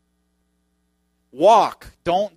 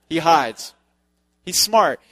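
normal talking voice

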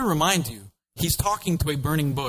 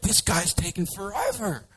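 much higher, whiny impatient crowd; impatient Israelites; 9 semitones higher than usual. Acted. Israelites complain about Moses on the mountain, high and whiny. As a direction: moan like a bored crowd waiting. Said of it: "all the people are like".

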